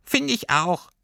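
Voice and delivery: high, squeaky pipe; squeaky little creature. Acted. Little Wanderlust creature agreeing with Lilli, squeaky high. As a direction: pipe it like a tiny furry critter. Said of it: "piepste das kleine, pelzige Fernweh".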